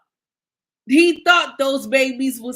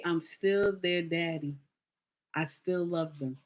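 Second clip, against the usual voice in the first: much softer and lower, heartbroken; quiet heartbroken; 8 semitones lower, 10 dB quieter than usual. Retold or imitated. The brother's hurt reply to Maury, hushed and sad. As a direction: let it sink into a hurt murmur. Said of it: "He say".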